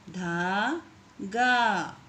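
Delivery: धागा stretched very slowly, syllable by syllable. Shown as a demonstration. Teacher to pupils, sounding out धागा very slowly. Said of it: "इस तरीके से आपको शब्दों को पढ़ना है".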